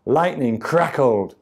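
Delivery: usual speaking voice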